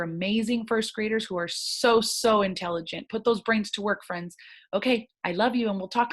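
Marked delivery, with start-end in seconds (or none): none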